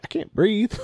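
high squeaky panic, rising at the end; a sudden high alarmed plea; mock panic. Patient retells to a friend not breathing in the chair, high mock panic.

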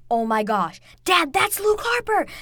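building to a high, loud cry; re-enacted excitement. Fan tells dad who just appeared, re-enacting the excited shout. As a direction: relive spotting a surprise return, rising to a shout.

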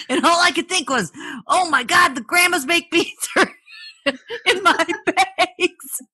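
laughing while talking. Real, not acted. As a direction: laugh through the punchline. Laughing at the beans.

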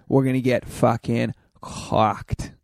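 slow imitation of a Boston cousin; Boston accent. Quoting his cousin meeting Tasha, Boston accent. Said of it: "'cause he's from outside Boston".

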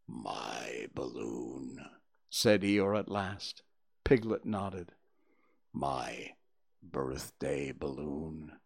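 low, slow, mournful donkey voice; low sad donkey murmur; gloomy Eeyore. A narrator's reading. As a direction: droop every word like a sad old donkey.

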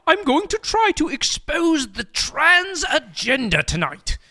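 high-pitched put-on British author; J K Rowling. Rowling answers her exasperated agent, high British voice. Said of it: "I will be J K Rowling".

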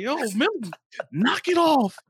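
high-pitched mock telling-off; retold scolding. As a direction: call out to scold a little kid.

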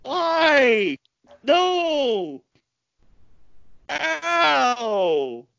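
high pained cry of no; hit hard crying out. Real, not acted. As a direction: yelp in sympathetic pain. Anguished cries at brutal move.

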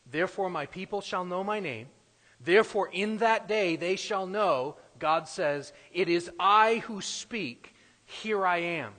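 loud, ringing voice of God; preaching at full force. Acted. Preacher voicing God's words to the church, loud and ringing. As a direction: proclaim it like God speaking.

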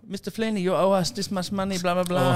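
high, loud put-on voice demanding payment; imitated hospital staff. Hospital staff chasing an unpaid bill, high mock-insistent voice. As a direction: play the insistent clerk, pitched right up.

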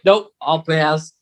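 ordinary talk

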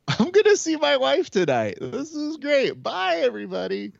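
high, laughing put-on goodbye voice; mock sign-off voice. Mock guest leaving the event, high put-on voice. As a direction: wave the crowd off in a cartoonish put-on voice.

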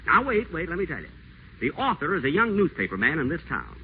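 high, gushing sweet talk; butter her up on the phone; honeyed phone charm. Acted. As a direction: pitch the voice up and coo over the phone line. Whiteside sweet-talks Lorraine on phone.

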